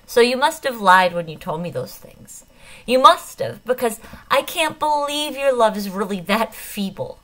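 loud, tearful insistence, stressing must; thick with tears. A narrator's reading. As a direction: hammer the accusation through tears. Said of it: "the thickness of my voice".

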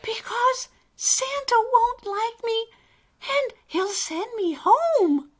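very high, small anxious voice; timid little character. Acted. Alistair tells the elf Santa will reject him, timid. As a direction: confess the fear in a tiny high voice. Alistair confesses his fear.

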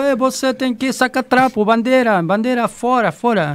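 high, loud mock guard barking orders; imitating a shouting guard. The fan retelling how a guard yelled at him to take the flag. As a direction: play the Brazilian guard yelling to pull the flag down. Security guard yelling in Brazil.

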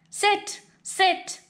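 high, sing-song sit commands; pet command voice. Acted. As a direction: train him like a puppy. Dog gives his human commands.

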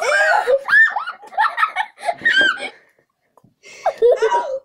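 high, loud laughing squeal; laughing shriek. Real, not acted. Gross bean, dropped on foot.

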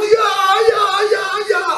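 high, repeated "ja"; imitated fans cheering. Two friends from the post cheering him, high excited cries. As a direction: rejoice like excited supporters.

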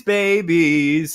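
normal talking voice

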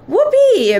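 high, loud whoop; loud squeal of delight; gleeful shout. A narrator's reading. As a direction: shout it out with storybook glee. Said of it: "Missus Jenkins shouted".

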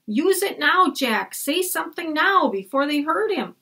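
put-on high, pressing girl's voice; urgent Annie. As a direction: urge him to act now. Annie urging Jack.

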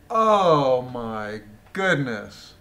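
slow, loud, drawn-out oh my goodness; exasperated astonishment, stretched out; can't believe what he's reading; disbelief. Real, not acted. Disbelief at testimony.

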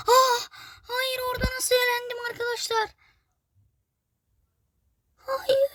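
high, yelped no of dismay; dismayed shock. Real, not acted. Gamer watching himself fall again, high dismayed yelp. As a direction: yelp as the jump fails.

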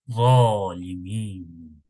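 ZALIMIN drawn out slowly, heavy lisped z; thick lisped z. Shown as a demonstration. Teacher giving an example word, slow thick-lisped Zalimin. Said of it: "kalın peltek idi".

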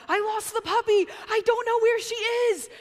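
high, loud panicked cry; frantic husband. Retold or imitated. Husband panicking on the phone over the lost puppy, high frantic cry. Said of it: "I get a frantic call from my husband".

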